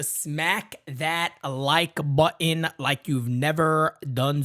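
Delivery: stretched out, very slow; slow emphatic plea. Real, not acted. Host begging viewers to hit like, very slow. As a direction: drag every word to make the point. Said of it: "urging viewers to like".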